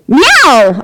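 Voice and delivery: loud angry meow after a scratch; hollered meow. Acted. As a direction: holler it back at her. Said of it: "I hollered".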